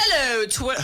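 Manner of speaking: a hammed-up British greeting; British accent. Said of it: "in a British accent".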